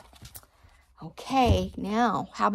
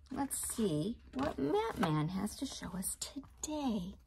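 secretive whisper; 6 dB quieter than usual. Shown as a demonstration. Teacher whispers to children before the page turns.

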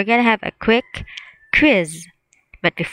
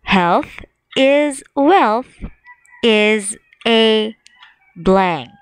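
slow, deliberate reading; slow quiz reading; much slower than usual. Shown as a demonstration. Teacher reading the quiz sentence slowly. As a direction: read it slowly for the children. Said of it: "reading quiz sentence slowly".

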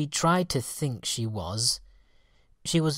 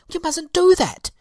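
very high, loud alarmed cry; cried out; 11 dB louder than usual. Acted. Fairies crying out at their sister, very high and loud. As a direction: cry out in shock. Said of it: "they cried".

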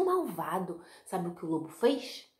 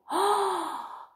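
dramatic gasp; 11 semitones higher, 3 dB louder than usual. A narrator's reading. Hunter opens belly.

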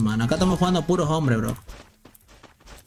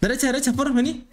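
high, urgent team callout; rally the squad forward; excited callout; 10 semitones higher, faster than usual. Real, not acted. Calling team to rush. (grito agudo y urgente)

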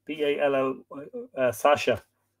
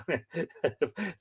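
soft, higher trailing laugh; amused chuckle; 5 semitones higher, 6 dB quieter than usual. Real, not acted. Reader still amused by Ballyscandal, quiet laughter. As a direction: chuckle on quietly at the silly name.

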